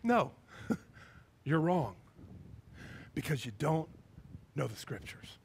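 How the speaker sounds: loving correction. Acted. Jesus answering the trick question with love, quiet loving correction. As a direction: correct them gently, letting each phrase sink. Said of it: "I can just hear he's got love".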